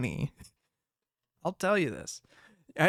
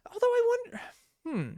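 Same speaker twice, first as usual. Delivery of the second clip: mock musing; 4 dB quieter, much faster than usual. Wondering about the glove compartment, a high musing voice. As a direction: float the thought up high. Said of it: "musing thought".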